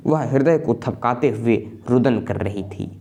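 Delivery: ordinary talk, nothing marked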